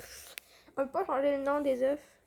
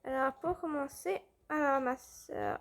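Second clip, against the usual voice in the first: usual speaking voice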